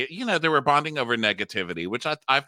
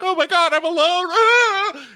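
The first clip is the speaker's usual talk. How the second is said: high, loud hammed-up panic with a yelp; mock panic; 8 dB louder, slower than usual. Friend mocks a lonely friend at the holidays, shrieking in mock panic. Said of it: "She's like".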